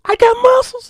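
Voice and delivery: put-on be a shy kid who can't believe it; childlike disbelief. Husband as a little boy doubting his wife's praise, squeaky and high.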